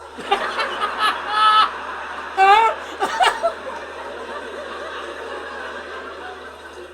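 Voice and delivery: high, loud laughing fit; real helpless laughter. Real, not acted. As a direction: burst out laughing. Laughing at the absurdity.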